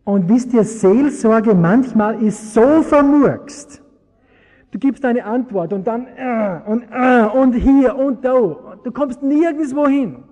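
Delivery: loud, high, slow preacher's outcry; full-force preaching. Acted. Preacher to congregation about messy counselling, loud exasperated preaching.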